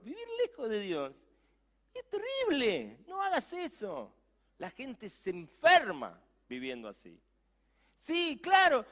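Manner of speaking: high, intense urging; impassioned preaching. Real, not acted. Preacher passionately warning his listeners. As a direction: cry out the warning.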